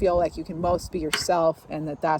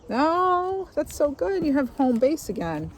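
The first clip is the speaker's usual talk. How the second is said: delighted; 8 semitones higher, slower than usual. Real, not acted. Delighted by the drawn card.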